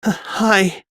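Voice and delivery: high, timid stammer; a nervous girl barely getting hi out; shaky stammered hi. Acted. Hiding girl greets the shopkeeper, high and timid.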